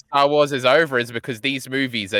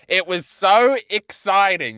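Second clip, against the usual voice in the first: high, slow gush landing hard on "exciting"; "exciting" stretched and stressed in a high voice; emphasis on exciting; 6 semitones higher, slower than usual. Acted. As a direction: draw out "so exciting" with big emphasis. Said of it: "Emphasis on the word exciting".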